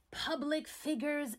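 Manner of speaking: usual speaking voice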